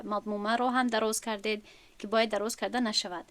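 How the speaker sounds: usual speaking voice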